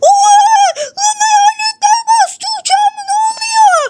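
yüksek, yavaş, korkmuş bağırış; korku. Acted. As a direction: hayalet görmüş gibi bağır. Murat hayaletlerden korkuyor. (uçağı basılan Murat korkuyla bağırıyor)

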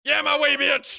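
high, loud gloating cheer; triumphant shout. Real, not acted. Gamer gloating at the fooled unicorn, loud. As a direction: crow over the win.

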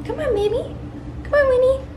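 very high, sweet coax; coaxing puppy talk. Real, not acted. Owner coaxing her puppy into its house, high coo.

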